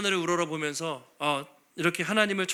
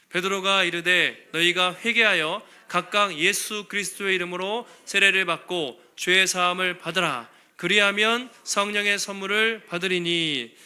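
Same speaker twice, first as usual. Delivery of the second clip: slow, loud unison reading; slow solemn Bible reading; scripture recitation; 5 dB louder than usual. Acted. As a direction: read the verse aloud with the congregation, measured. Congregation reads verse together.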